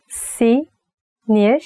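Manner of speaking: "Sinirliyim" said slowly, vowel by vowel; slow and split. Shown as a demonstration. Teacher showing an angry slowed "Sinirliyim". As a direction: stretch "Sinirliyim" apart to sound upset. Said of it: "divide it to the vowels, and you can say it slowly".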